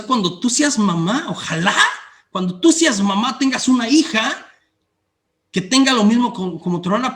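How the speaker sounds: hammed-up angry mother cursing her daughter; retold mother's curse. Preacher voicing the angry mother cursing her rude daughter, mock scolding.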